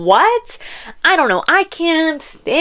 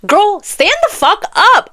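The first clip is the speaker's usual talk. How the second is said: high, slow, emphatic telling-off; exasperated scolding; 9 semitones higher, slower than usual. Acted. Scolding a heroine in a book, exasperated. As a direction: draw out each word as an exasperated order.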